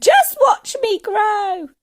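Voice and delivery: high, slow triumphant yell; drawn-out, very loud crowing; boastful shout. A narrator's reading. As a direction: holler it like a gleeful old woman. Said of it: "she shouted".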